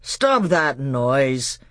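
very slow, heavy command; furious uncle silencing a child; stern order, each word drawn out; angry scolding. Acted. Uncle scolds the crying boy.

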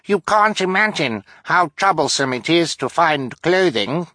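put-on boy straining for a high voice; high falsetto. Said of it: "in as high a voice as he could manage".

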